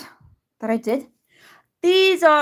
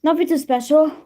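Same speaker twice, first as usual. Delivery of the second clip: normal talking voice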